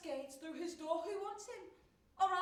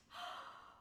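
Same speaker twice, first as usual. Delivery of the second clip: gasp; 8 dB quieter than usual. Acted. Shocked gasp at woman.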